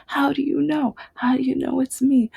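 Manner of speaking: very high-pitched happy wonder; delighted amazement. Retold or imitated. Boy whose name Santa signed, delighted amazement. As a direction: imitate an astonished, overjoyed kid. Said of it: "he had the biggest smile".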